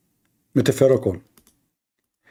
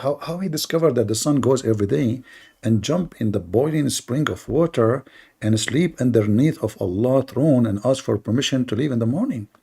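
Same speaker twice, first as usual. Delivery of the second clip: ordinary talk, nothing marked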